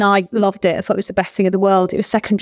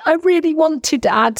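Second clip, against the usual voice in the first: high, whiny plea to her dad; mock childish begging, pitched up; pleading child; 8 semitones higher than usual. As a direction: beg like a teenager who wants it badly. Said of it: "was like".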